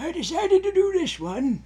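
put-on high, wavering old lady voice; old person voice. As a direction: croak it out like a frail granny. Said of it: "Should I do an old person voice?".

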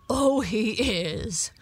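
loud, drawn-out dog bark. A narrator's reading. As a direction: bark emphatic agreement, slowly. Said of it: "barked Don".

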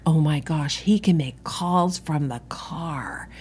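breathy, slowing awed whisper; awed hush. Acted. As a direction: hush it like amazed gossip. Voicing awed reaction to car phone.

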